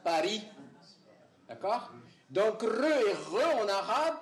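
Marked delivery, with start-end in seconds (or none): 0.0-0.4 "Paris" with a tongue-tip trilled R; rolled R. Shown as a demonstration. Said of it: "le R roulé qui est coronal". 2.6-3.6 "re et re", first rolled, then uvular; high, slow contrast of the two "re". Shown as a demonstration. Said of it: "en berbère sont phonimiques".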